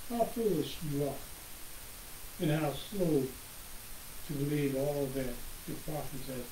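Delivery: ordinary talk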